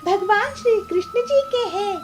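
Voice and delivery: high-pitched, cheerful heroine voice; sweet devout laddu seller, grateful. Acted. Peelu thanking Sundari didi for her praise, high and grateful.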